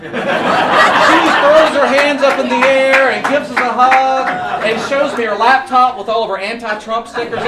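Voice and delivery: high, loud, jubilant shout over cheers; exultant storytelling at full volume; triumphant excitement. Real, not acted. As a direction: belt out the happy punchline.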